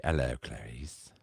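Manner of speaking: low, slow, soft creepy greeting; slow sinister hello; Hannibal Lecter voice. Retold or imitated. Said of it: "he did say".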